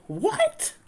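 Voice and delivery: very high incredulous squeak; as if told to burn money; shocked. Acted. Nellie shocked at Dan, very high squeak.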